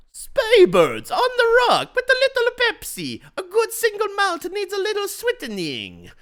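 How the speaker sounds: very high, loud silly voice; hammed-up Stevens. Stevens ordering from the waiter, high silly voice. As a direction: squeak it out like a pompous buffoon.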